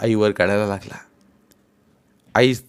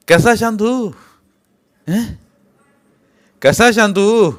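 angry retort; 11 semitones higher, 7 dB louder than usual. Acted. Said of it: "त्यानं तिचा हात एका बाजूला ढकलला".

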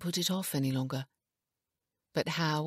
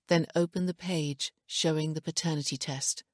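ordinary delivery, nothing marked

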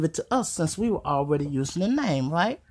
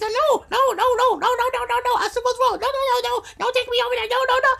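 very high, panicky little voice; the stuffed bird refusing to go, tiny frantic squeal; scared stuffed bird; faster than usual. Pretend. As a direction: squeal like a terrified toy.

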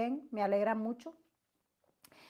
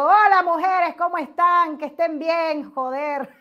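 loud, brash hello to the ladies; her loud greeting; 6 semitones higher, 10 dB louder than usual. Retold or imitated. Showing followers how she really greets them, loud and brash. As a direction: greet them big and brassy. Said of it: "yo vengo y les digo".

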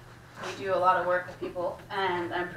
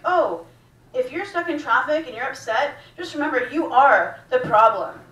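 mocking city officials; 5 semitones higher, 9 dB louder than usual.

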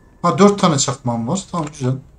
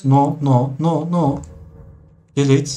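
usual speaking voice

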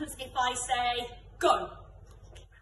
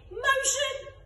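excited game call; 14 semitones higher, 5 dB louder than usual. Shown as a demonstration. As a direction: squeal the word motion high and bright. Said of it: "And finally".

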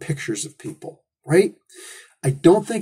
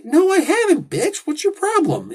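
indignant retort; 13 semitones higher than usual. Retold or imitated. Mock reply to pushy tourist.

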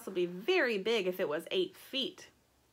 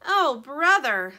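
loud, slow, higher lament; exasperated little bird; 5 semitones higher, 10 dB louder, slower than usual. A narrator's reading. Little bird learning the thief is their brother, slow, loud exasperation. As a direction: draw it out in big dismay.

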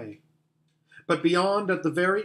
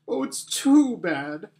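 high, loud lament, lightly acted sob; mock sobbing; 5 semitones higher, 3 dB louder than usual. Lucy at the broken table, acted sobbing. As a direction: protest through pretend tears. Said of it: "sobbed Lucy".

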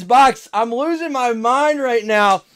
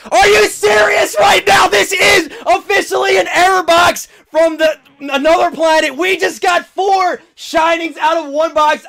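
excited shouting; 6 semitones higher, 4 dB louder than usual. Real, not acted. Four shinings from one box.

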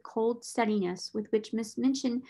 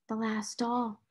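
small, softly mournful murmur; 4 dB quieter than usual. A narrator's reading. Orphaned girl turning toward her birthday doll, mournfully. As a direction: say it quietly, with sorrow. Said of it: "mournful voice had an odd sound".

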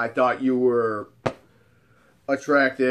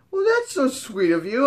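higher, sweet feminine lilt; imitated flattered woman; 9 semitones higher than usual. Hot friend to admirer, imitated flattered sweetness. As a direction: play her touched by the compliment.

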